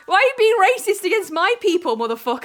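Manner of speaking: loud, high playful indignation; mock outrage. Defending their people from a teasing friend, mock outrage. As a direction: protest as if wildly offended. Mock quarrel over mocking Cornish.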